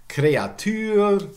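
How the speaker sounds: "Kreatur" as kray-a-TÜR; high, loud "Kreatur"; three syllables in "Kreatur", French u; French-style pronunciation. Shown as a demonstration. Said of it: "more examples".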